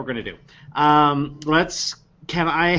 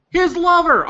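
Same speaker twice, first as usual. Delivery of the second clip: excited shout; 4 dB louder than usual. Real, not acted. Excited suggestion.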